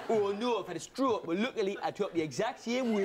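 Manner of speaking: mock Geordie lament; Geordie accent. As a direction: put on a comic Geordie accent. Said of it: "Geordie is the only accent".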